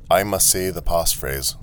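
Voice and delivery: lightly robotic statement of "I must say the passphrase"; automaton. Acted. Automaton telling the traveller its rule, evenly robotic.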